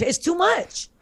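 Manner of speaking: worked-up, high-pitched comic protest; getting heated. Real, not acted. Podcast guest griping about rival's double win, getting heated. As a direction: let exasperation push the voice way up. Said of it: "I'm getting heated again".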